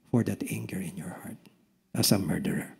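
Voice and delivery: low whisper at the end. Real, not acted. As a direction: drop to an unvoiced whisper. Preacher drops to a whisper.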